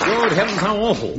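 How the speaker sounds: high, prim gasp of horror; mock shock. Agent reacts to the news, prim and high. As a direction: clutch the pearls, high.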